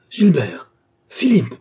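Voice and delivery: loud, high cry of horrified recognition; shocked shout, pitch leaping then falling; explosive cry. Acted. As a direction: burst out with the name like a thunderclap. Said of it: "Ces deux mots éclatèrent en même temps, comme un tonnerre souterrain".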